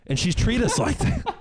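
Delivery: high, laughing exasperation; laughing outrage. Real, not acted. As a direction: rant while breaking into laughter. Laughing at own mock rant.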